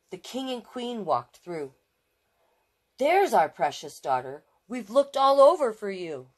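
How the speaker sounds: plain reading voice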